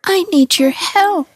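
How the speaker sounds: very high, tiny mushroom voice; a tiny mushroom calling up to Hans; delicate little voice. A narrator's reading. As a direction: squeak it up small and pleading. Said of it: "a delicate little voice calling".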